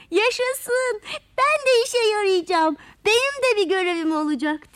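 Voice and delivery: high, loud cheer; joyful shouting. A narrator's reading. Little creature given a job at last, shouting for joy. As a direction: cry out with delight. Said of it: "diye bağırmış".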